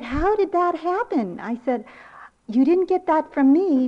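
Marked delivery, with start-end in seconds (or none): 0.0-1.4 high-pitched playful astonishment; mock amazement. Grandmother teasing granddaughter about her attitude, mock amazement. 2.5-3.9 loud, high teasing disbelief; playfully protest, pitched way up; mock amazement. Grandmother teasing granddaughter about her attitude, mock amazement.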